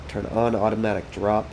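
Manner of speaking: ordinary delivery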